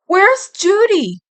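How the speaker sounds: high, loud, slow demand; firm question. A narrator's reading. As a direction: press her for an answer. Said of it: "he asked firmly".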